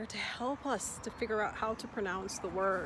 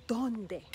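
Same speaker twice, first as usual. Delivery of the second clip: donde punched on DON, falling after; donde, strong O, light ending; stress on the O; 3 semitones higher, 3 dB louder than usual. Shown as a demonstration. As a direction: hit the first syllable of donde. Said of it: "you don't put the emphasis anywhere else".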